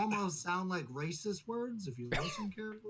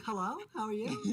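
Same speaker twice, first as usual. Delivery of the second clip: plastic voice; 5 semitones higher than usual. Pretend. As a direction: fake cheerful phone voice, higher. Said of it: "put on totally fake voice".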